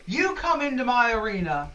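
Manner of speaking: incredulous, blustering boss, then bargaining; Fritz, affronted arena boss. Acted. Arena boss offering intruders a fight, blustering. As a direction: scoff at the cheek, then lay out terms.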